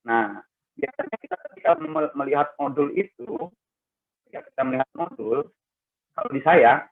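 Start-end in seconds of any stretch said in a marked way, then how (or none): none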